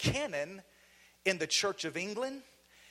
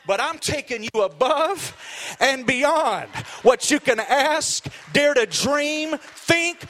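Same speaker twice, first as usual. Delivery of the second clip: loud, high-pitched rallying sermon; full-force preaching; 5 semitones higher, 12 dB louder than usual. Real, not acted. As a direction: build it up, stretching the key words loud.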